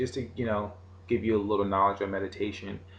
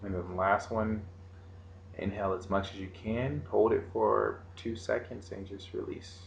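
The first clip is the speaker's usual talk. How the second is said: guided meditation; 4 dB quieter than usual. Acted. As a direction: lead the breathing calmly.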